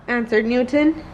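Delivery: ordinary delivery, nothing marked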